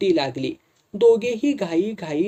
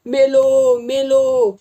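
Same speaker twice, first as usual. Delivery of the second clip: high, loud yelps of pain; old farmer howling under the blows; pained cry; 8 semitones higher, 7 dB louder, much slower than usual. Acted. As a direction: cry out as if being beaten. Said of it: "करून तो ओरडला".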